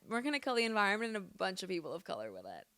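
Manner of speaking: fast, muttered mock quote. Mocking the Oppenheimer makers, a fast soft muttered quote. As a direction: rattle it off quickly and softly like a sneering insider. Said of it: "And then they said".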